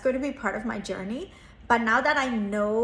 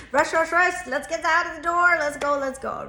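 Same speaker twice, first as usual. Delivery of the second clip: mock morning rush; 6 semitones higher, 6 dB louder than usual. Mimicking a rushed school morning.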